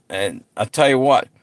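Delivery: ordinary delivery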